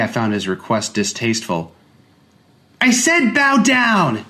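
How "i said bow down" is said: put-on high, slow, loud child's command; shrill playground order to kneel; bossy child. A narrator's reading. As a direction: play a bratty boy ordering an alien. Child demanding.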